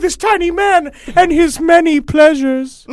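high, hammed-up pleasured voice; high mock moan. Voicing Barbara Bush.

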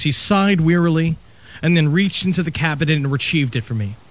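normal talking voice

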